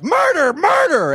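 high, drawn-out retold cry of alarm; mock butler shouting the alarm; butler's yell. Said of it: "the butler yelled".

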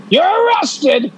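slow, stiff police officer; mock stern cop. Mock cop arresting lovers.

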